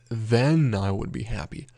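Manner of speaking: THEN punched, the rest falling away; emphasize THEN above the other words; stress on THEN. Shown as a demonstration. Teacher showing learners what is emphasized, THEN stressed. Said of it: "you're sort of emphasizing".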